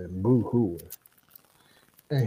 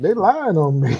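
high, whiny-comic protest of innocence; aggrieved devil; 5 semitones higher, 4 dB louder than usual. Retold or imitated. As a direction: play a wronged devil pleading his case. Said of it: "He said".

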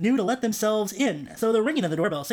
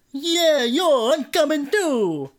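relative voice; 7 semitones higher, 6 dB louder, much slower than usual. A narrator's reading. Another relative joins.

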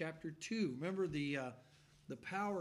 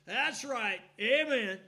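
loud, higher call-out; loud amen; 4 semitones higher, 10 dB louder, slower than usual. Retold or imitated. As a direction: call it across the room. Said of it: "somebody over here would go".

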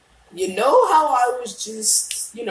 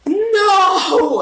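shout; 9 semitones higher, 5 dB louder than usual. Real, not acted. Dies in game, cries out.

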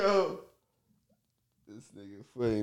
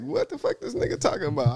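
much higher, baffled outburst; confused disbelief. Retold or imitated. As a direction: sound totally lost. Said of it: "I'm like".

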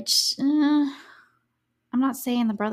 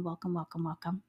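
soft welcome; 4 semitones lower, 10 dB quieter, faster than usual. Shown as a demonstration. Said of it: "as I'm saying".

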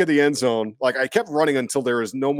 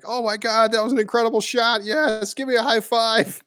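high, delighted cheer; mock ecstatic fan; thrilled; 11 semitones higher than usual. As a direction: gush over the shot. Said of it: "I'd be like".

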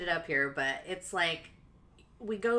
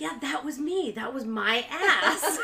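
higher, brighter proud quote, slow on the last words; imitating soccer mom; 8 semitones higher, 7 dB louder, slower than usual. She quotes the soccer mom proudly bragging about the poster, light imitation. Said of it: "she's like".

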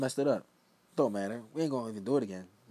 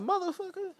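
much higher, pitched-up disbelief; mock outraged exclamation. Hearing he can play late, a high mock outburst. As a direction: yelp it in mock shock. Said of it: "I said".

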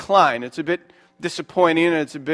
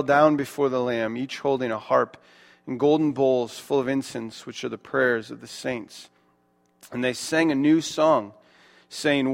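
scripture reading; 4 semitones lower, 4 dB quieter than usual. Acted. Reads Revelation 5 aloud.

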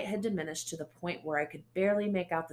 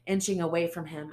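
ordinary delivery, nothing marked

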